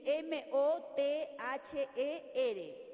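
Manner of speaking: very slow, each letter spelled out in Spanish. Shown as a demonstration. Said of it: "¿Cómo lo pronunciarías?". (deletreo lento, letra por letra)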